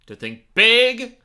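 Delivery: high, loud, slow dramatic voice; movie quote voice. Acted. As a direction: boom it like a villain. Quoting Spy Kids line.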